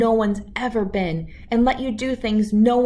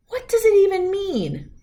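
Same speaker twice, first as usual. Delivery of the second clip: high, loud scoffing disbelief; mock the idea outright; scornful question; 12 semitones higher, 4 dB louder than usual. Doubting scientist scoffs at the formula, incredulous.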